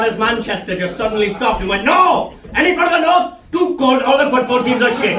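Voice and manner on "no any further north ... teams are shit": high, loud mock refusal. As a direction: call it out high, like someone digging their heels in. Acted Southern view of immigrants stopping.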